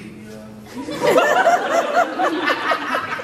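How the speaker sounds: cracking up at the diarrhea joke; high giggling fit; high burst of laughter. Real, not acted.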